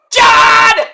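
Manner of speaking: high, loud, drawn-out name; announcer's name holler. Acted. Introducing Moxley's arrival to listeners, big drawn-out announcer holler. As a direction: blast the name out long like an entrance call. Hypes a wrestler's dramatic entrance.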